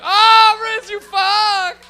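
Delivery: very high, loud mock scream; acted panicked yell. Comic retells a scary car ride, mock-panicked yelling. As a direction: shriek it like a terrified passenger.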